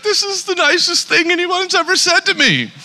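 high, moved woman's voice, lightly done; a hint of a tearful stranger, high; imitating crying lady. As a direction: mimic a touched woman through tears. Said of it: "she just starts crying".

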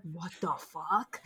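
soft, slow disbelieving mutter; stunned disbelief. Retold or imitated. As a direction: drop it, stunned and appalled. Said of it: "you're just like".

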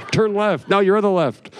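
high, urgent call to the driver; urgent directions. Retold or imitated. Passenger calling out to his friend driving, high and urgent. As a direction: blurt the directions in a rush.

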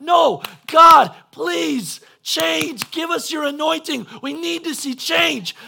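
high, loud opening cry to God; impassioned pleading prayer. Shown as a demonstration. Preacher showing how prayer should sound, impassioned pleading. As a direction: beg God at full voice. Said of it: "This is what it's supposed to sound like".

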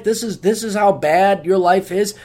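ordinary talk

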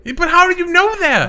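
high, quick, insistent question; alarmed questioning. Acted. Woman pressing man about what he knows, alarmed questioning. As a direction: press hard for an explanation.

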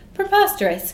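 high, loud outraged cry; cried out. Acted. Onlooker in the crowd cries out in outrage. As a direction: shout it up at the sky in disbelief. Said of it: "cried a third".

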